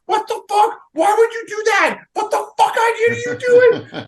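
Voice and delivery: very high-pitched mock screaming; imitated kid's scream. Parent imitating thirteen-year-old cursing at a Bears game, high mock scream. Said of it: "And she just screamed".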